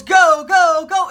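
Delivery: loud shouted go go go; jump master yells. Retold or imitated. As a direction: yell it out the open door. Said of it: "the jump master yells".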